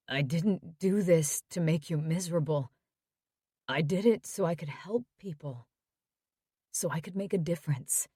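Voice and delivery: quiet, tired plea from a hospital bed; injured son. Acted. Tyler voiced by narrator.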